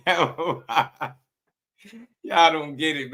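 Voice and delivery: laughing through the words, high-pitched. Real, not acted. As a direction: crack up while reacting to the clip. Cracking up at the played clip.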